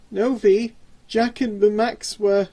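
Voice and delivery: high, loud, nervous stammer; a terrified burglar stammers to his boss. Acted. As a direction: stumble over the words in fear. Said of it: "stammered James".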